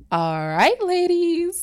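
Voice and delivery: high, loud bright call; forced cheer. Retold or imitated. Missus Reef calling to the ladies after nearly crying, loud forced cheer. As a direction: cover the hurt with loud cheer.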